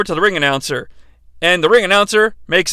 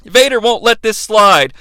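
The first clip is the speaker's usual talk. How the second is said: normal talking voice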